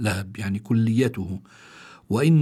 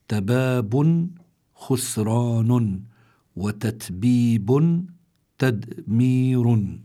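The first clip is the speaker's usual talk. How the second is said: very slow, each word set apart; slow word-by-word gloss; much slower than usual. Shown as a demonstration. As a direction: spell out each term slowly with full endings. Dictates glossed words one by one.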